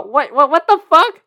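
what-the-hell outburst; incredulous. Retold or imitated. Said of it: "I, I go".

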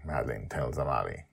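plain reading voice

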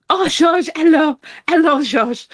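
put-on high, loud, feminine French accent; Nicole's French accent. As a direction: coo flirtatiously to a lover in a French accent. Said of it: "in the way she would say it".

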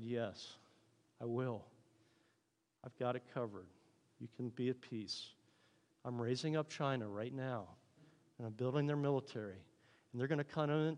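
quiet, softly voiced God, in full control; calm reassurance. God answers the believer's prayer, quietly reassuring.

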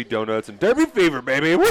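loud, high burst of hype; jacked up. Real, not acted. Co-host owning up to Derby fever, jacked up. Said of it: "I'm all jacked up".

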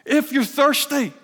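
a preacher calling the crowd to come, with a loud cry. Acted. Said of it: "it says, with a loud voice".

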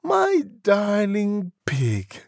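put-on slow, high, gushing young lady; high, syrupy endearment. A narrator's reading. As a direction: coo it in a drawn-out falsetto. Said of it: "she said".

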